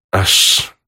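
voiceless, drawn-out hiss; hissed command. A narrator's reading. Туман hisses the awaited command to his flying killer, hissing. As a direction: hiss it out like a whispered order. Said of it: "прошипел Туман".

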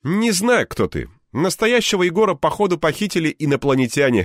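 high, cheerful buddy voice; a jolly friend brushing it off; friend Anton. A narrator's reading.

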